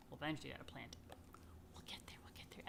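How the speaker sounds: soft unvoiced aside; whispered we'll get there. Real, not acted. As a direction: drop to a whisper to reassure. Aside to children while reading.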